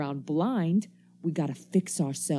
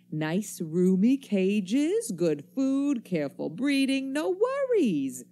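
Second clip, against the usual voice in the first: drawn out and rising higher to a sneer; sneering mockery; 5 semitones higher, slower than usual. Stranger jeering at the commuters' comfortable captivity, slow and climbing. Stranger mocks caged humans.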